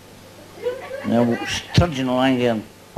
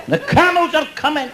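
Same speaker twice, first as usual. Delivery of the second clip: mock shout. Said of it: "a big shouting up".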